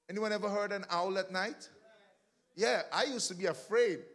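ordinary talk

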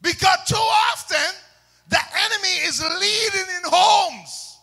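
high, loud pulpit shout; preaching at full force. Real, not acted. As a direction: proclaim it to the whole church at full volume.

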